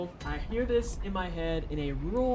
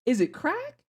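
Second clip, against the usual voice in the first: disbelief; 10 semitones higher than usual. Real, not acted. Asking in disbelief.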